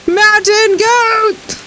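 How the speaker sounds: high, slow, stretched mountain goat call; slow drawn-out goat cry. Pretend. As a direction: drag out mountain goat like a proud bleat.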